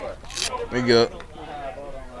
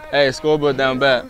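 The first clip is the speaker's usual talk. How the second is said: ordinary talk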